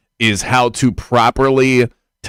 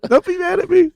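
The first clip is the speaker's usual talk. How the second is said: much higher, whiny mock of a timid reporter; wimpy reporter. Host mocking the reporter apologizing to the coach, high whiny pleading. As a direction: squeak it out like a scared reporter begging. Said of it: "how wimpy most members of the media are".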